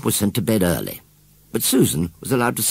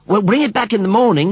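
put-on high, loud little girl calling; high girlish call; young girl's voice; 13 semitones higher, 7 dB louder than usual. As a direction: call it back, girl's voice raised. Susan's voice in a story.

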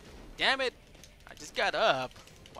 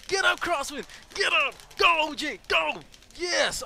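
loud, high cheering; excited yelling; 12 semitones higher, 10 dB louder than usual. Real, not acted. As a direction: yell your partners back up. Cheering teammates during match.

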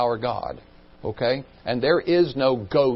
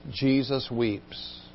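hushed; 2 semitones lower, 9 dB quieter, slower than usual. A narrator's reading. Pathos of the moment.

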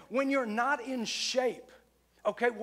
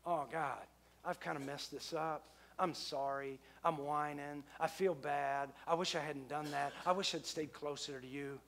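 lower, softer, whiny apology to God; mock whining prayer; 6 semitones lower, 7 dB quieter than usual. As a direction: act out a sorry, moping prayer. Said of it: "instead of whining".

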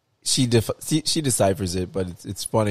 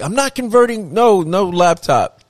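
put-on stubborn old man; 10 semitones higher, 6 dB louder than usual. Father-in-law refusing laptop, voiced.

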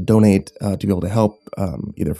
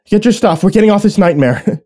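urgent order; 11 semitones higher, 5 dB louder than usual. Acted. Passenger orders escape from train.

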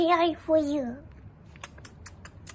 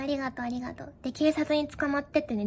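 ordinary talk

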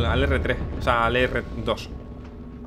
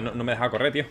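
usual speaking voice

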